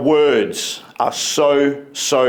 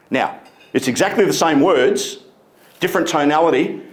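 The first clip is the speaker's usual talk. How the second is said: ordinary talk, nothing marked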